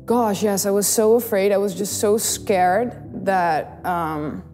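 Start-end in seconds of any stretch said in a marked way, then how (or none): none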